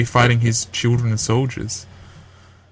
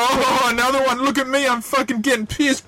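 high, hammed-up boastful drunk mate; mock drunk bragging; 9 semitones higher than usual. Mocking a mate bragging online, in a high silly voice. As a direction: play the smug mate showing off his drinks.

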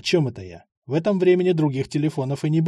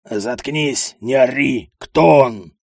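angry bark; 2 semitones lower, 5 dB louder, slower than usual. Acted. Участковый обрывает крик.

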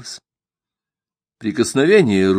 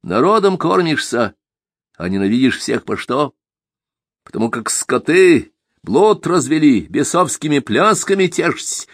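scornful accusing rant; 6 semitones higher than usual. Acted. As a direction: lash out at the old man. Simagin voiced in narration.

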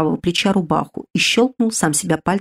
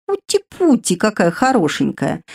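cooing spirit; 3 semitones higher than usual. Acted. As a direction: coo at her like a treat. Spirit coos over the girl. (высокое сюсюканье)

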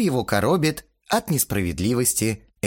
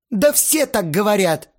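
high, raised sulky retort; sulky defensive; 11 semitones higher, 5 dB louder than usual. A narrator's reading. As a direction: insist sullenly. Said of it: "Астарот насупился". (обиженно, высоким голосом)

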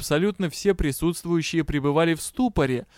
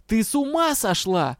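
mildly raging, pitched up; 6 semitones higher than usual. A narrator's reading. Дроу rages on at colleague working without contract, indignant. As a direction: keep storming at her. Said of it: "продолжал бушевать".

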